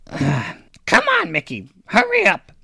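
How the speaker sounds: high-pitched crone hurrying a servant along; impatient old hag. Acted. Hag tugging her stitched demon by leash, impatient high voice. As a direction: urge them on in a high hag voice.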